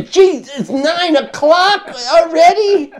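high, loud, laughing cry of disbelief; startled and laughing. Retold or imitated. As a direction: replay the shock of seeing the clock, cracking up. Retold panic at hotel, laughing.

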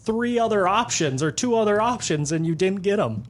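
high, fast, exasperated "you gotta be kidding me"; exasperated disbelief. Retold or imitated. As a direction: throw your hands up at the fish in mock outrage. Retelling fish missing the hooks.

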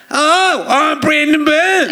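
high, slow, shouted Aussie voice; Brendan Burns impression. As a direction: bellow your own name like a brash Aussie comic. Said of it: "I did an impression of Brendan Burns".

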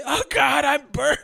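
high, loud wail breaking into laughter; mock agony, barely holding back laughs; hammed-up yell. Amused retelling of a burning man, hammed-up yell. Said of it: "is yelling when he's burning".

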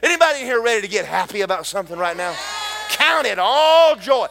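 hammered sermon rhythm with Hallelujah refrains; full-force preaching. Acted. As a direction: build the congregation up, shout it out.

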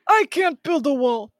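hammed-up brash Trump; Trump impression. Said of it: "that's what Trump sounds like".

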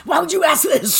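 high, strained mock shout; hammed-up outraged question; exasperated scolding. Imitating Mommy scolding Melody's questions, strained mock outrage.

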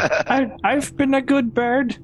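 high, slow bird voice; high pleading creature voice; plaintive owl voice. Acted. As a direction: voice a wronged pet bird, pitched high. Voices the owl being thrown.

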